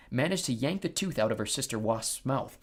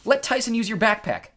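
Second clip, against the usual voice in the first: high, louder urgent order; yelled instruction; 9 semitones higher, 6 dB louder than usual. A narrator's reading. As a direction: yell the instruction across the cab. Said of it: "I yelled".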